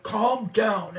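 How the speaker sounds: huge being's command. Retold or imitated. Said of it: "he was huge".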